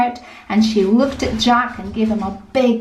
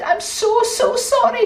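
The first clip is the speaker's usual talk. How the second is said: tearful apology; 11 semitones higher than usual. Acted. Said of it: "she ran to her father crying".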